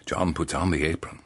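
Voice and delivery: ordinary delivery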